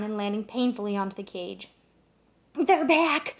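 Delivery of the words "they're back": ordinary delivery, nothing marked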